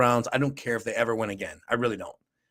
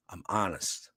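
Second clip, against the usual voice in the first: much lower and softer, a murmured echo; 6 semitones lower, 10 dB quieter, slower than usual. Shown as a demonstration. Echoing himself to viewers, low murmur. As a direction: let it sink low and quiet like an afterthought. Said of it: "repeats line, second time low".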